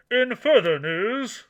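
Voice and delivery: slow booming anchor voice; news announcer voice. Pretend. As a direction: open like a TV newscaster. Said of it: "announcer voice on".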